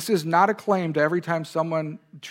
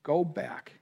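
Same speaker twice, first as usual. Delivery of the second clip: quiet command; 4 semitones lower, 7 dB quieter, much slower than usual. Retold or imitated. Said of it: "God tells Hagar in this instance".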